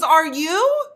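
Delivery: high, loud retort; exasperated. Retold or imitated. Partner turning her question back on her, exasperated. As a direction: imitate him throwing the question back. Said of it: "his exasperation".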